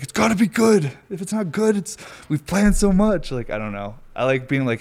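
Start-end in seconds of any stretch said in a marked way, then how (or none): 0.0-3.3 high, loud, anxious mock freak-out; mock panic. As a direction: act out panicking that the plan must pay off. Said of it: "feel like I'd have a bad trip".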